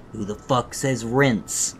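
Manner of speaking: usual speaking voice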